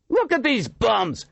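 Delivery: high, slow jeering scream; young man jeering the homeless, screaming. A narrator's reading. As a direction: scream it at the crowd. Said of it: "screamed a young man".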